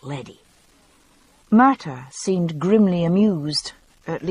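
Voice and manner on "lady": "leddy" with a short e, Scots vowel for lady. A narrator's reading. A Scot saying lady as "leddy". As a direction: say "leddy", almost but not quite. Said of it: "the word was almost, though not quite".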